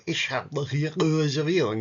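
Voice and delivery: "Ich habe hier eine Reservierung" in Swiss German, hard consonants, swallowed syllables; Swiss German accent. Shown as a demonstration. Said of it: "concentrate on the consonants and you swallow quite a bit".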